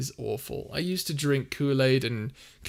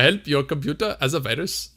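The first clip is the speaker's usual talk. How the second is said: badly faked Indian accent, a little raised; 2 semitones higher than usual. Shown as a demonstration. Streamer reading a chat request aloud, terrible fake Indian accent. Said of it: "my terrible attempt at an Indian accent".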